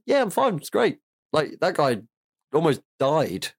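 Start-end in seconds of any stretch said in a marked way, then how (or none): 0.1-1.0 high-pitched, chirpy brush-off; mock breezy reassurance. Retelling Guy Martin's hospital reply, high-pitched mock nonchalance. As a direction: imitate someone insisting all is fine, pitched way up.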